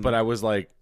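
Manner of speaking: ordinary talk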